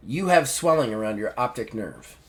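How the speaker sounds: low, quiet deadpan doctor; imitating a matter-of-fact doctor; very casual. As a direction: break the news casually, without any alarm. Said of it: "Just very casually".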